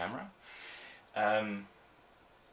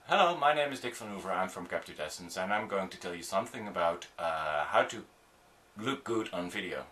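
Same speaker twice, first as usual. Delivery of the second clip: too fast. Shown as a demonstration. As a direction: rattle off your introduction without breaks. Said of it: "That's too fast".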